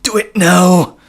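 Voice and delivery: high, loud villain order; hammed-up shouted goading; loud mock command. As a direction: belt out a playful demand. Repeats the goading, louder.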